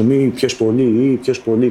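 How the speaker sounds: a hint of a stadium chant; imagined fans shouting. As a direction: call it out like a crowd of fans, half-hearted. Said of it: "μου φώναζε".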